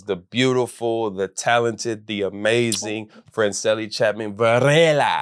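rolled R's, slow and lingering on Varela; purring latin lover. Acted. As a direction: purr the name with rolled R's. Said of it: "You rolling the R's?".

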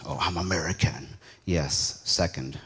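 normal talking voice

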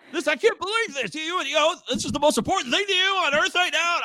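high-pitched ranting re-enactment; imitated parking-lot yelling; mock screaming and shouting. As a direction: rant in strained disbelief, without full volume. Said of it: "I'm screaming and shouting".

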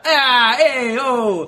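loud, high mobster hollering; goofy mobster caricature. Retold or imitated. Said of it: "He's always been the".

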